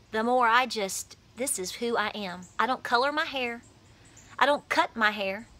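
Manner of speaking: ordinary talk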